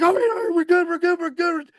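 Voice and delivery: high, excited relief; relieved cheer. Retold or imitated. Russ on the boat after the spin, high and excited. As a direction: shout it out, we made it. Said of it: "he's like".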